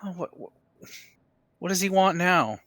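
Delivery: high-pitched, put-upon question; sputter, then complain about the new demand; exasperated. Acted. Player dreads master's new demand.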